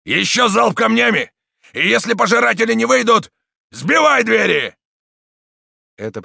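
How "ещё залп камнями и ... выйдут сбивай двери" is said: loud, high battle orders; thunderous shout. A narrator's reading. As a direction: bellow orders, stretching the last. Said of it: "громовой голос камнелома".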